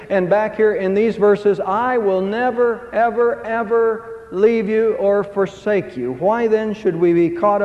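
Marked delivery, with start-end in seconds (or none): 1.6-6.1 slow, high, stretched "never, ever, ever"; emphatic never ever ever. Acted. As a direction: draw out every "ever". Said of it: "most emphatic statements".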